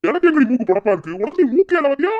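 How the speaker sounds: husband hamming up outrage at his wife; mock indignant retort. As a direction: fire back at the wife in mock offence. Playful spousal quarrel, retorting.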